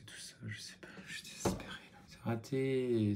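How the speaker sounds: hushed, defeated whisper; despairing whisper. Real, not acted. Whispering to the camera over a ruined makeup, despairing.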